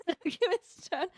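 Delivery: giggling through it; laughing. Real, not acted. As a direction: laugh the words out.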